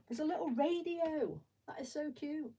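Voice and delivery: suddenly thrilled by a tiny piece; delighted surprise. Real, not acted. Builder spotting a little Lego radio, gasping high coo.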